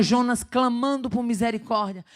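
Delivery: ordinary talk, nothing marked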